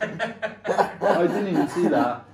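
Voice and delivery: slow, words broken up by laughing. Real, not acted. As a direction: talk through real laughter. Cracks up at what he missed.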